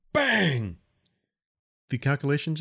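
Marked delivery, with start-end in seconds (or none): 0.1-0.8 sharp shouted bang like an impact; loud bang. A narrator's reading. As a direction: punch it out like a hit on the hull. Ship hit by rocks.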